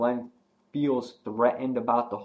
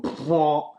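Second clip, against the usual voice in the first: mouth-made gun blast, higher, louder; gunshot noise; 5 semitones higher, 4 dB louder than usual. Retold or imitated. Abraham's casual shot, mock gunshot noise.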